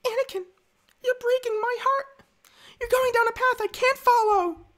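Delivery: very high, put-on feminine falsetto; high-pitched mock heartbreak; Padme. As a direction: plead melodramatically in falsetto. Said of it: "my Padme impression?".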